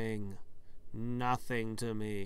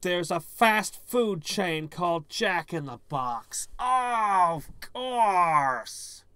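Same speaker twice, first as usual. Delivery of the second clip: loud outburst, building higher on "Oh, of course"; exasperated realization; 4 semitones higher, 7 dB louder, much slower than usual. Real, not acted. Player belatedly getting the Jack in the Box clue, exasperated. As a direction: cry out as the obvious answer finally lands.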